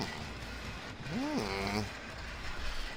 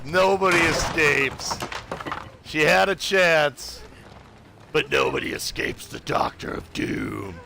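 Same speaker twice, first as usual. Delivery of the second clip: mad scientist; 3 semitones higher than usual. Acted. Villain gloat after kill.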